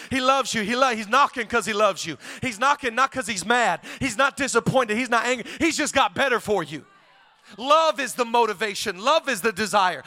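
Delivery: ordinary talk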